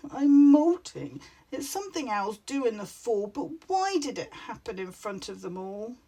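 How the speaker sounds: trembling, tearful owl voice; wobbly voice. A narrator's reading. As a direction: near tears, voice wobbling. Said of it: "His voice was all wobbly".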